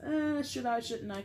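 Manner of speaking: mock a nervous dithering between two choices; trepidatious. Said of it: "it's like that trepidatious".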